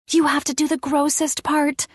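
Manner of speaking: put-on high-pitched kid voice; a child laying down game rules; little girl. A narrator's reading. Addison instructs the narrator.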